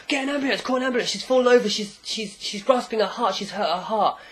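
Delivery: high, mock-frantic crowd voice; panicked bystanders. Crowd round a fallen old lady, high hammed-up panic. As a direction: act out onlookers calling for urgent help.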